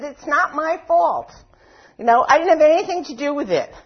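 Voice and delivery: whiny victim. Retold or imitated. Speaker voicing her inner victim, whining. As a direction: whine like the wronged victim. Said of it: "you can hear it whining".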